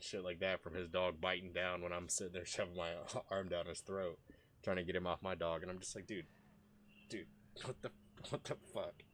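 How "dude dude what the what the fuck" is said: hushed, stunned exclamation; soft, halting, near-whispered reaction; retold disbelief. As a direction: say it under your breath in disbelief. Retold stunned reaction after attack.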